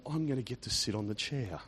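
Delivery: very low, hushed murmur; private thought. Acted. Voicing a volunteer's thought about the chair, low hushed murmur. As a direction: murmur it as if to yourself. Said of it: "you're thinking to yourself".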